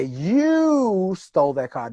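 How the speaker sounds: You stole that car said slowly, weight on You; slow stressed demo. Shown as a demonstration. Showing the class how emphasis changes meaning, stress on You. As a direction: lean hard on You, slowly. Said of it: "every time I change the emphasis".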